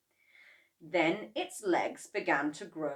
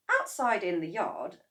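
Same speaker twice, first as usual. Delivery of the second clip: ordinary delivery, nothing marked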